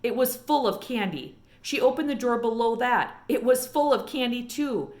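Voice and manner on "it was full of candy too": ordinary delivery, nothing marked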